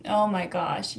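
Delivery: ordinary talk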